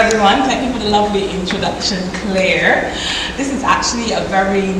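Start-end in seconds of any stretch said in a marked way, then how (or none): none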